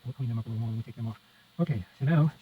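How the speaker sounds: ordinary talk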